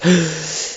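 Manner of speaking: mock startled gasp; re-enacted quiet gasp; imitated gasp. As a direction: act out a soft intake of shock. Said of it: "I'm just like".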